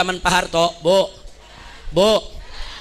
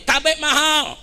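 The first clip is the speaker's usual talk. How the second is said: higher-pitched imitation of complaining people; mock protest cry; 5 semitones higher, slower than usual. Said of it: "Nih rakyat berteriak nih". (seruan protes tiruan)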